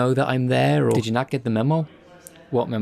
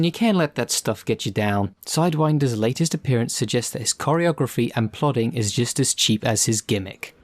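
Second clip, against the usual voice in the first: ordinary delivery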